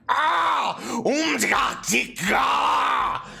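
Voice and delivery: loud, high, slow monster bellow; furious giant roar. Acted. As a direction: roar it out in pain and rage. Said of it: "he's like".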